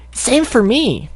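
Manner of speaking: high, slow, strained squeak; a failed squeaky filly attempt; bad Sweetie Belle voice. Shown as a demonstration. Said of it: "uh, that was terrible".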